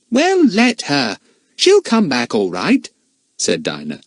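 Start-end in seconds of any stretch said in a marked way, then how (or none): 0.1-2.9 put-on sleepy, irritable girl; grumpy young girl brushing off; cross girl voice. A narrator's reading. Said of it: "Dinah could be so fierce when she was cross".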